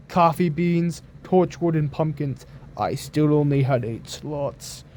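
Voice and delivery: ordinary delivery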